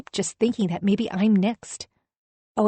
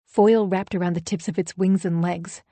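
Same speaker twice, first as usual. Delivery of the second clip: plain reading voice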